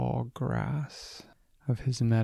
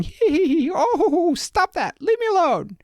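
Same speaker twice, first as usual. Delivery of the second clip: very high, loud giggling squeaky voice; tickled goblin; 8 dB louder than usual. A narrator's reading. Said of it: "the goblin started going".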